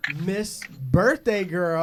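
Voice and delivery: very slow, loud and drawn out; grand announcement. Acted. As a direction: stretch it like a ring announcer. Introducing co-host grandly.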